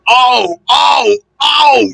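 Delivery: high, shouted storybook yelps; mock cries of protest; crying out. A narrator's reading. Trucks cry out as engine backs.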